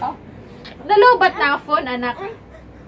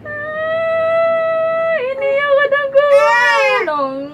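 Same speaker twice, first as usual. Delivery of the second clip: high, slow mock crying shout; mock wail; 14 semitones higher, much slower than usual. Mom teasing her crying baby by wailing high and slow. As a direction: wail it back at him like a crybaby.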